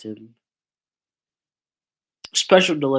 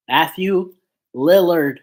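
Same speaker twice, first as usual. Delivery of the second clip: both names said separately and louder, LILLARD hit hardest; careful re-attempt; 4 semitones higher, 8 dB louder than usual. Real, not acted. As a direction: push out "Matthew ... Lillard" word by word, forcefully. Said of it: "Jesus Christ, my mouth".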